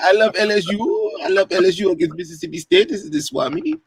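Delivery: put-on high-pitched hammy swami accent; Zoltar. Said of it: "That's my Zoltar impersonation".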